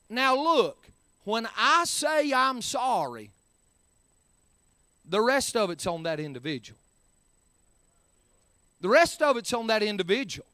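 normal talking voice

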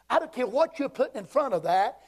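ordinary talk

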